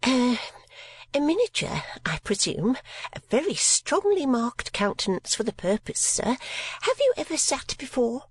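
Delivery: high, affected voice of a prim spinster; mincing lady of fifty. Acted. Miss La Creevy eagerly greets a possible sitter, high and mincing. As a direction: simper at a hoped-for customer.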